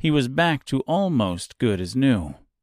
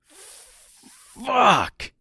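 high-pitched shriek of a curse; yelped, much higher scream; pained scream; 13 semitones higher than usual. A narrator's reading. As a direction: scream it out in sudden pain. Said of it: "he screamed".

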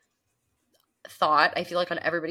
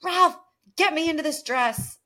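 mild mock scream; 9 semitones higher, 4 dB louder than usual. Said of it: "goes and screams at Ralph".

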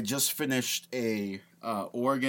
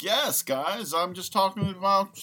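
higher voice slurring into a mumble; mock mumbling self-impression; 8 semitones higher, 4 dB louder than usual. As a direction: imitate your own tipsy podcast chatter. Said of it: "trying to talk to you without mumbling".

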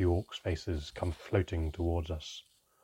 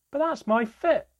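much higher, louder whiny protest; complaining; 8 dB louder than usual. Acted. Narrator protesting the stolen fish, high whiny complaint. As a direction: squeal it like a cheated child. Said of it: "I began to complain".

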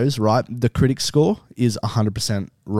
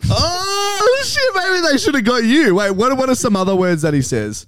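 laughing; 13 semitones higher, 4 dB louder than usual. Real, not acted. Still laughing in high voice.

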